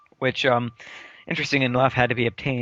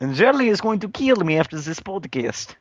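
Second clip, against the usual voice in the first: hammed-up Russian accent, a bit higher; 5 semitones higher than usual. Shown as a demonstration. Joking to the co-host about trouble after the podcast, mock Russian accent. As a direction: keep up the joke Russian accent. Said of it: "Russian accent like I can".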